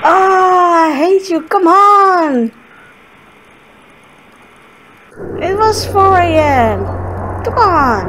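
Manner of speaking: loud exasperated complaining; frustrated. Real, not acted. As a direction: complain loudly at the game. Frustrated at game at 4 AM.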